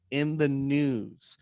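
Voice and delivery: "news" slow and drawn out; deliberate, stretched-out delivery of "news"; clarifying the title. Real, not acted. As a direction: spell out "news" slowly so nobody hears another word. Clarifying segment title against nude.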